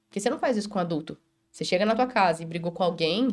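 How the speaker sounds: usual speaking voice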